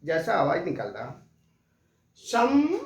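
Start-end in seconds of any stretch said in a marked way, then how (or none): 2.2-2.8 शम and सम closing on a humming म with the lips shut; सम said with the mouth closing on a nasal म; anusvara demo. Shown as a demonstration. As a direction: hum the final म of सम through the nose. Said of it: "अनुस्वार के लिए जो एक उच्चारण".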